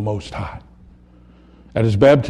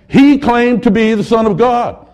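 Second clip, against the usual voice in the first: accusing; 9 semitones higher, 5 dB louder than usual. Acted. Leaders accusing Jesus before Pilate.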